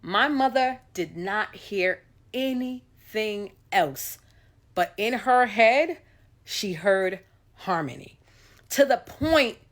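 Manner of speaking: normal talking voice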